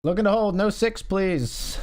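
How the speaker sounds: high hopeful pleading. Real, not acted. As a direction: beg the cards, stretching the last words. Pleading with the cards.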